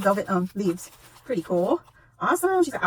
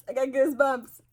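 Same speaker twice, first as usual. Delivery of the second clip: normal talking voice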